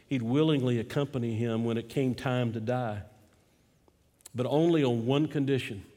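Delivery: ordinary talk, nothing marked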